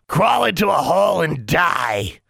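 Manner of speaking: a nasty pet screaming back; creature shouting back. Acted. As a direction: rude magical pet yelling insults. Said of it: "Honey shouted back".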